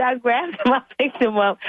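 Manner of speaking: ordinary talk, nothing marked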